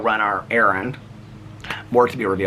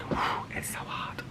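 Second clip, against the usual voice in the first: whisper; 14 dB quieter, much slower than usual. Real, not acted. Tired in the heat.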